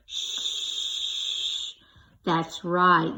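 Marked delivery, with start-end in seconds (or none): 0.1-1.7 long shh sound. Shown as a demonstration. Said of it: "Let's listen to the beginning".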